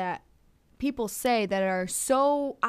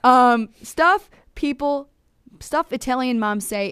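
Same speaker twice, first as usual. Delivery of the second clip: loud, playful, drawn out; loud, giddy mock announcement; hammed-up excitement; 4 semitones higher, 9 dB louder than usual. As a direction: ham up the fill-in-the-blank title. Hammed-up title fill-in.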